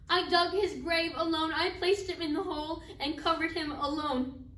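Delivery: a bereaved mother forcing out each hard fact; anguished grief. Acted. Acted mother recounts burying son alone.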